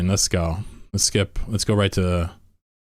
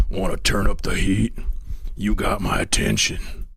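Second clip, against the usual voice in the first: Firebat. Acted. Streamer imitating a game unit, put-on rough voice. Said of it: "Where's the fire bat?".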